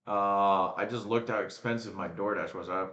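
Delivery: normal talking voice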